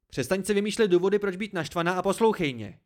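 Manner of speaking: high, loud, sharp order; barked. A narrator's reading. Narrator orders Dena to listen, barking. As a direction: bark it to cut them off. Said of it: "štěkl jsem".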